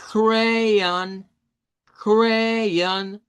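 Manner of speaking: "crayon" stretched into cray-on, on a held level tone; dos sílabas. Shown as a demonstration. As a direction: model "crayon" slowly as two syllables, cray plus on. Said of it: "dos sílabas". («crayon» alargado y sostenido: cray-on)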